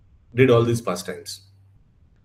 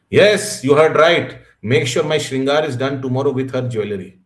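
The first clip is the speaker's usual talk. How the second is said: emphatic divine command; 3 semitones higher, 6 dB louder than usual. Retold or imitated. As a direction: thunder the order as the Lord. Lord insists to scared pujari.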